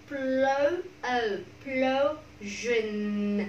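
"Plo-" held long and strong; stressed second syllable. Shown as a demonstration. Teacher marking the stressed syllable, "Plo-" drawn out strongly. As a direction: lean on "Plo-", then let "sion" trail slowly. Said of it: "âm tiết thứ hai nhận âm".

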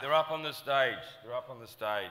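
loud, emphatic reassurance; retold loud insistence. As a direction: push the point home at full voice.

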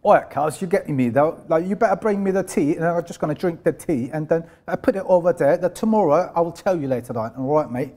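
put-on casual London street accent; London street English. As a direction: talk like a London street lad. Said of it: "bizim sokakta öğrendiğimiz İngilizce". (Londra sokak İngilizcesi)

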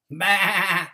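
loud bleating bah; sheep bleat. A narrator's reading. Sheep answering duck with a loud bleat. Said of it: "said Sheep".

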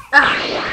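breathy loud grunt of pain; pained fight cry. Acted. Fighter struck by the fused kids, pained cry. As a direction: gasp like a blow landed. Character hit during fight.

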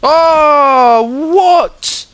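high, loud disbelieving shout; shrieking what in disbelief; shocked outcry. Real, not acted. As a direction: yell in outrage at a cheap kill. Knifed unexpectedly in game.

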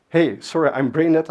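usual speaking voice

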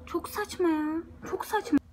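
annoyed. Real, not acted. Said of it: "Bak gene sinirlendim".

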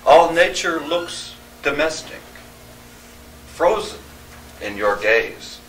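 declaimed verse, line openings lifted; poetry reading. Acted. Poet reading on wildlife art to an audience, declaimed verse. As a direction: read it in measured lines with deliberate pauses. Reads own poem aloud.

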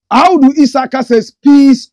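loud, high announcement of the name; excited reading. Real, not acted. Shouting out a viewer's comment on the live, excited. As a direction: call the viewer's name like a hype man.

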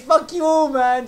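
loud, high exasperated outburst; frustrated shout. Real, not acted. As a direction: yell it out in frustration. Frustrated after two team kills.

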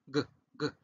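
"g, g" as isolated voiced throat stops; guttural G. Shown as a demonstration. Teacher drills the end of dog, guttural "g, g". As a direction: pop out two short hard "g" sounds. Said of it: "Esta G es gutural".

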